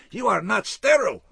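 high, loud alarmed cry; horrified shout. Acted. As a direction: shout in panic at him. Surgeon horrified at intruder.

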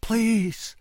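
a captive begging from below; calling out. A narrator's reading. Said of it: "he called".

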